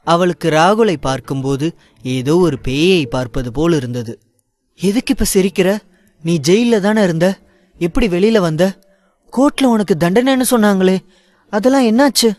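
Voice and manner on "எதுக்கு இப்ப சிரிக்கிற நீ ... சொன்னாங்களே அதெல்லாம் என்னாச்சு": high, alarmed questions rising in pitch; scared woman grilling the man who came out of jail; frightened demand. Acted. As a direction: confront him with fear in the voice. Ayesha confronts the freed convict.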